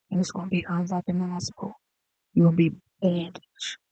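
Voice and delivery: ordinary delivery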